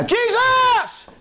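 very high, shouted cries broken by hushes; crying out to Jesus. Retold or imitated. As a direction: scream for Jesus over the crowd. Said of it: "he cried so much the more".

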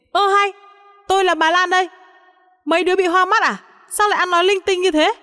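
very high-pitched indignant protest; exasperated grandma raising her pitch, not her volume; narrated shout. A narrator's reading. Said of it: "Tôi hét lên". (hét lên phản đối, giọng rất cao)

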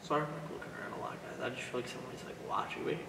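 soft unvoiced aside to viewers, a bit fast; nervous whisper. Real, not acted. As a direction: whisper to the camera, uneasy. Ghost hunt, feels watched, asides.

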